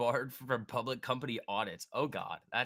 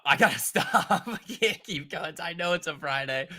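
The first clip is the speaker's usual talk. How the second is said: laughing; 6 semitones higher, 8 dB louder than usual. Real, not acted. Streamer losing it on a Friday, laughing. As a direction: try to stop laughing and fail.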